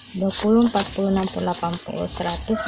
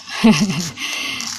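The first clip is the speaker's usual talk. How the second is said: short laugh. Real, not acted. Laughing at the tedious saving.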